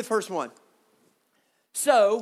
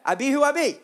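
higher, louder, with a grin; casual, teasing slang take; playful slang delivery; 5 semitones higher, 4 dB louder, faster than usual. Shown as a demonstration. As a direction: toss it off like street slang, tongue in cheek. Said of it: "That's what God is saying".

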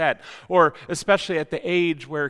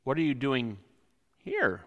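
soft and low, a touch suspicious; quiet pointed question; 6 semitones lower, 7 dB quieter than usual. Shown as a demonstration. Preacher shows a rude tone, low and soft. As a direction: ask it quietly, as if catching someone out. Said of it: "depending on the context and the inflection".

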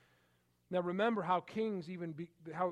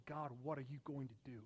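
much softer, quicker, wondering question to God; hushed prayer; 3 semitones lower, 8 dB quieter, faster than usual. Retold or imitated. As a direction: ask it softly under the breath. Voicing his own prayer.

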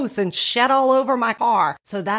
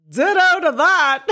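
higher, louder, snooty big sister; older sister's voice; 8 semitones higher, 4 dB louder than usual. Retold or imitated. Reading the older sister's text, higher and snooty. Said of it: "Here's my older sister".

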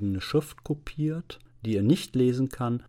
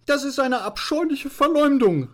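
very high, loud indignant protest; angry outcry; 5 dB louder than usual. Acted. Veronika defending old Liese against slander, shrilly indignant. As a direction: cry out in outraged young lady's voice. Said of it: "rief Veronika mit zornglühenden Augen".

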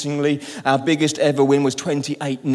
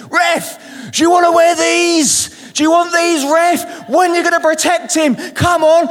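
high, loud yell at the referee; retold shout at the ref; 13 semitones higher, 6 dB louder than usual. Father yelling at the referee to protect his son, loud and high. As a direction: bellow it from the sidelines. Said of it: "my dad started shouting at the referee".

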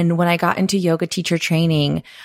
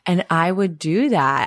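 usual speaking voice

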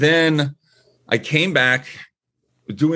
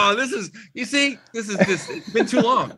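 laughing; 9 semitones higher than usual. Real, not acted. Laughs at emotional greeting.

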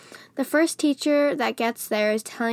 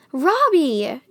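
loud, higher call of the name; exclaimed; 5 semitones higher, 6 dB louder than usual. A narrator's reading. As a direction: cry out the name. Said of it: "Derek exclaims".

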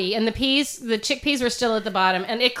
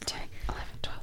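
counting in a whisper; 16 dB quieter, much faster than usual. Real, not acted. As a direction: breathe the numbers. Counting under breath.

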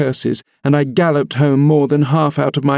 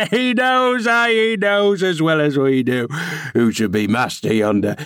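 put-on gloating old man; 2 semitones higher than usual. A narrator's reading. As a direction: savour it with spiteful glee. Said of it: "malignant, crackly laugh".

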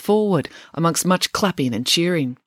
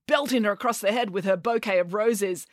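ordinary delivery

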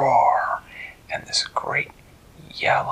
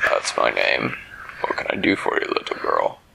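deep dragon voice; 13 semitones lower than usual. A narrator's reading. Dragon Hermes answers.

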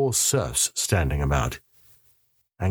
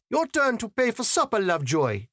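much higher woman's voice, firm; Alicia's voice; 13 semitones higher than usual. A narrator's reading. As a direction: cut in brightly and take charge. Alicia teasing Lovejoy.

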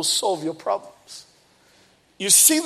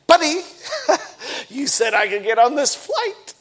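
laughing in disbelief, pitched up on buddy; incredulous buddy; 5 semitones higher than usual. Retold or imitated. Retelling his protest to the gate agent, incredulous. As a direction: laugh off the broken promise.

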